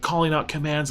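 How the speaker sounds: ordinary talk, nothing marked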